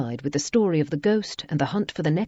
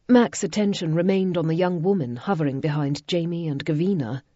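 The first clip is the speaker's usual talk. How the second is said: plain reading voice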